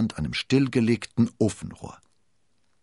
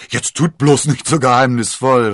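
loud, raised exclamation; calling out; 6 semitones higher, 12 dB louder than usual. A narrator's reading. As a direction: cry out over your friends, pitch lifted. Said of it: "rief D'Artagnan".

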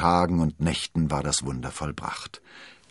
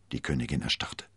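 plain reading voice

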